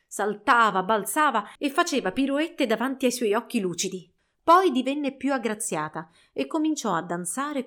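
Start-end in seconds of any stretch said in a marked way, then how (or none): none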